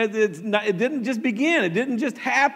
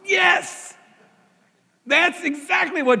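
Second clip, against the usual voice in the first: high, loud burst of "Yes!"; excited shout; 10 semitones higher, 4 dB louder than usual. Real, not acted. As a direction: shout it out in triumph. Excited answer to her.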